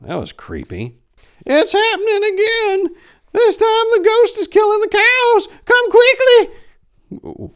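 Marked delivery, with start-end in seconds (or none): 1.5-7.5 very high, loud frantic shriek, last words stretched slow; panicked old woman. A narrator's reading. Old woman begging the priest to come, shrieking. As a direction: scream for help like a terrified old farm wife.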